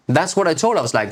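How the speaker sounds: usual speaking voice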